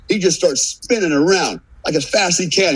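ordinary delivery

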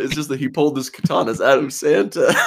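can't stop giggling; laughing. Real, not acted. Laughing at the mistake.

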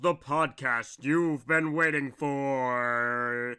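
booming slow ringmaster announcement; deep, drawn-out showman intro. Acted. Podcast announcer opening.

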